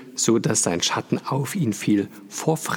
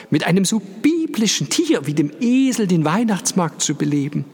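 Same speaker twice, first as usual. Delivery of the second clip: high, gushing society lady; chatty fur-clad lady; 8 semitones higher, 3 dB louder than usual. A narrator's reading. Fur-trimmed lady chatters to Paul about the donkey, high and gushing. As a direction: chatter up high, pleased with yourself. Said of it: "gesprächig".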